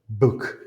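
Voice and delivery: book with vibrating cords on B; voiced B. Shown as a demonstration. Teacher contrasting with power, voiced B in book. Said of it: "les voiced".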